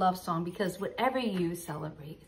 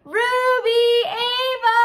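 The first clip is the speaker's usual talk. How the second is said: calling out; 12 semitones higher, 9 dB louder, much slower than usual. Acted. Calling out to the guests.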